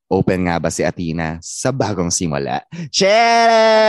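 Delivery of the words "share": loud call. Real, not acted. Host calls out to guest.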